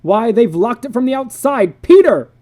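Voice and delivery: high, loud alarmed yell; panicked shout. Acted. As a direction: yell through the door. Father panicking at door.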